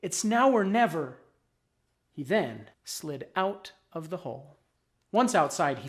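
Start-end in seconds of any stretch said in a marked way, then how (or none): 0.0-1.2 raised, higher voice of resolve; said out loud. A narrator's reading. Worm urging himself out of the hole, out loud. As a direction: declare it aloud to steel yourself. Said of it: "said out loud".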